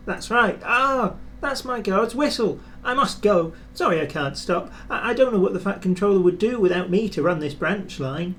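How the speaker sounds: a bustling, important little engine; busy Thomas. A narrator's reading. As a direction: rush the last line like you're needed elsewhere.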